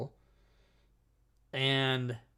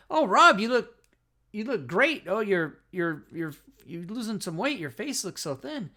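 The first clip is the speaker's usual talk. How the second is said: mock fawning praise, pitched much higher; gushing friends; 7 semitones higher, 5 dB louder than usual. Imitating friends at the Improv gushing over his weight loss, high and fawning. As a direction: gush like a chatty acquaintance. Friends greeting him after months.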